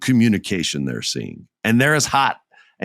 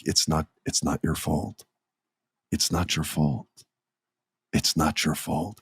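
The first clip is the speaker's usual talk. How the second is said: much softer, lower, gentle repeated reassurance; small and tender; 6 semitones lower, 7 dB quieter than usual. Retold or imitated. Robin Williams comforting Matt Damon, soft tender reassurance. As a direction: comfort him like a therapist consoling a weeping patient. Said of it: "so sweet and small and tender".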